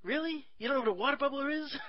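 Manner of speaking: high, disbelieving squeak; incredulous. Retold or imitated. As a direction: act stunned they don't know. Retelling reaction to confused people.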